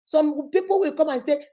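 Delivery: ordinary talk, nothing marked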